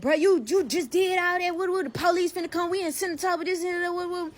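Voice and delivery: mock yelling that tapers in volume; imitated screaming. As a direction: run it on as a high panicky-scream imitation. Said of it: "Greg out there screaming like".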